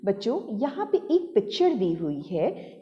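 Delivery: ordinary talk, nothing marked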